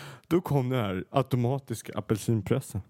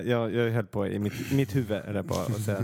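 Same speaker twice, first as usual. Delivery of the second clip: ordinary talk, nothing marked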